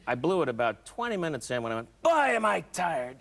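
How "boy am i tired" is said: high, slow, stretched-out call; showy put-on exclamation; mock loud announcement. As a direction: blurt it slowly for the whole theater. Acts out his loud cinema remark.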